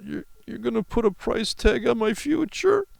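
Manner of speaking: high-pitched mocking kid whine; mock whining. Mocking a girl's speech on TV, high whiny outrage. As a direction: whine it indignantly in a put-on high voice. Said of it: "And whining about".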